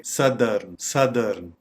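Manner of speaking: "सदर्न" as SUH-dern; correct pronunciation. Shown as a demonstration. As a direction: say "सदर्न" with a short first vowel. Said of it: "तो हम कहेंगे".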